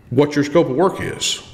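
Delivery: normal talking voice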